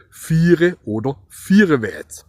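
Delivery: "vierewärts" said slowly, in broad Swabian; Swabian word. Shown as a demonstration. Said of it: "das sagen wir im Schwäbischen". (schwäbisch ausgesprochen: „viere oder vierewärts")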